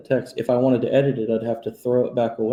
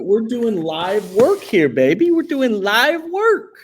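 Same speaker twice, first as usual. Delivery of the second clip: excited; 10 semitones higher, 5 dB louder, slower than usual. Real, not acted. Hyped about live work.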